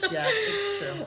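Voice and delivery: laughing. Real, not acted. Laughing agreement.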